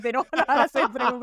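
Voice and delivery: laughing through the words, a bit quick. Real, not acted. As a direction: giggle it out, amused by the fans. Laughing about fans' pronunciation.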